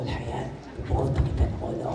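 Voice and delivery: timid unvoiced whisper; a hushed doubtful whisper. Shown as a demonstration. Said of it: "hindi bumubulong".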